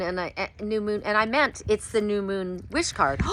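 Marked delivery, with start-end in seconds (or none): none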